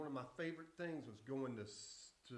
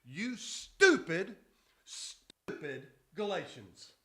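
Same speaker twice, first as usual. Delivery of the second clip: very loud, slow shout of stupid; hammed-up preacher's scolding roar; shouted slow quote; 3 semitones higher, 13 dB louder, much slower than usual. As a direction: bellow each stupid, dragging it out. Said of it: "He goes".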